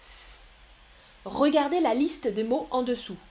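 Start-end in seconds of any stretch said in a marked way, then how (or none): 0.0-0.6 "s" as an isolated, lengthened hiss; soft C sound. Shown as a demonstration. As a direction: hold "s" with no vowel. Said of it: "le C se prononce s". (« s » sifflé et prolongé)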